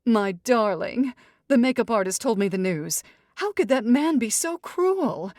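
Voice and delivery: haughty mother fussing over daughter; dramatic society mother. Acted. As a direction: sweep in like a grand matriarch. Mother of the bride voice.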